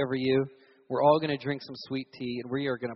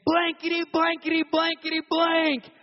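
very high, loud, drawn-out gasp of amazement; high squealed "Oh my gosh"; astonished shout; 12 semitones higher, 8 dB louder, slower than usual. Retold or imitated. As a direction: burst out in shocked disbelief. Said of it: "jumps up and goes".

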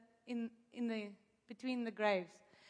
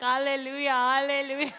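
hallelujah; 8 dB louder than usual. Retold or imitated. Rain starts, mock-triumphant praise.

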